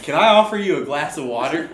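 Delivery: loud, suspiciously pushy water offer. Acted. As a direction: make a polite offer sound sketchy. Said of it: "made that sound way suspicious".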